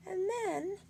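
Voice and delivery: ordinary delivery, nothing marked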